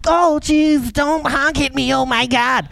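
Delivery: high, hammed-up wail of protest; mock overreaction. Driver pleading not to be honked at, mock wailing. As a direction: overreact in a high, drawn-out whine.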